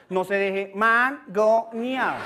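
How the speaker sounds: "mangonear" stretched out very slowly; slow phonetics demo. Shown as a demonstration. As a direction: spell it out slowly for gringos. ("mangonear" muy lento)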